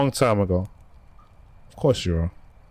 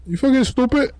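incredulous yell. Real, not acted. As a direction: yell up high at a dumb move. Yelling at game character.

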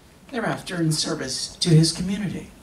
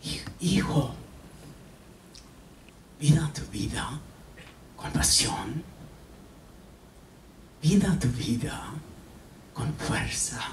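soft unvoiced whisper in Spanish; whispered quote; 4 dB quieter than usual. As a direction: breathe the mother's last words. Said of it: "she whispered".

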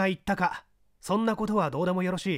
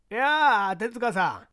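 put-on old gentleman; 4 semitones higher, 5 dB louder, slower than usual. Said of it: "小太りに太った老紳士だった".

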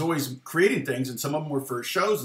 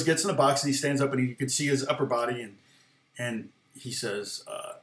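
ordinary delivery

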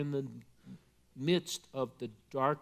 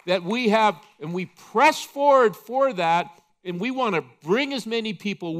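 louder, rising sermon push; preaching at full force; 4 semitones higher, 6 dB louder than usual. Acted. Preacher stirring the church toward heaven, fervent. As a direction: drive the congregation forward.

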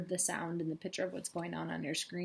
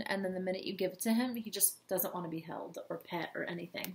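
ordinary talk

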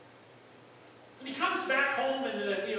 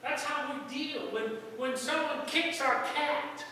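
ordinary delivery

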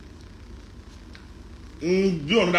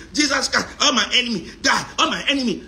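imitated fiery prayer; 7 semitones higher than usual. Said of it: "I go".

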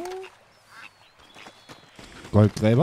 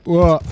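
startled yelp; 7 semitones higher, 9 dB louder than usual. Real, not acted. Startled by horse in game.

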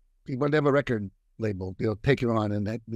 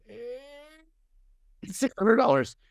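strained high hesitation, then a raised-pitch answer; mock nervous squeak; 12 semitones higher, much slower than usual. Naming his fee to a caller, mock-nervous and squeaky. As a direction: squeeze the price out nervously, high. Uneasy quoting of own fee.

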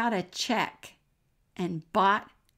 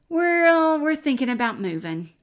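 much higher and louder, bursting out; blurted announcement; 8 semitones higher, 10 dB louder than usual. Retold or imitated. Telling the neighbor daughters about the move, loud and high.

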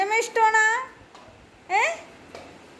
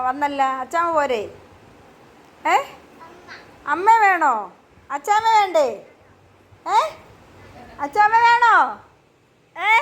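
ordinary talk, nothing marked

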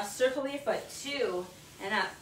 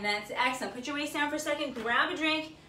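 normal talking voice

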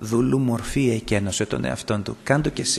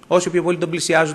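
normal talking voice

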